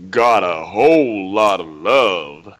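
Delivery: low, slow soldier drawl; marauder impression. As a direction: lay it on slow like a cocky trooper. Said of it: "Same th-, same unit?".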